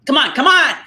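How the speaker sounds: high, loud impatient cheering-on; insistent pleading with a laugh at the end; eager urging. Real, not acted. Egging friend to do voice.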